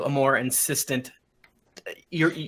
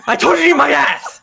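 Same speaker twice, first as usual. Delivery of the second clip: loud, high in-character scream; 12 semitones higher, 9 dB louder, faster than usual. Acted. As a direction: yell it out as an enraged king. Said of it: "who screams".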